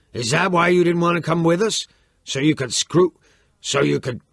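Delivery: high, loud accusing shout; furious shouting. Acted. Chico shouts at his father, accusing and furious. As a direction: hurl the accusation at him.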